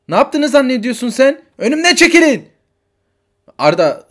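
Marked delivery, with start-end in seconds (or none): 1.6-2.5 put-on high, loud kid calling out; a desperate little boy hunting for mom; frantic child. Child Arda searching the station for his mother, frantic.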